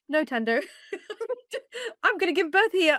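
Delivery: laboring mother insisting she'll give birth on the street; laughing protest. Retold or imitated. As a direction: giggle out the refusal. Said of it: "And I was like".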